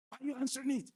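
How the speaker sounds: high, loud, fast angry scolding; aggressive. Shown as a demonstration. Husband demonstrating an aggressive reply to his wife, loud angry scolding. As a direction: snap at her like an accusing spouse.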